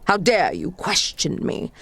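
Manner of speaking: ordinary talk, nothing marked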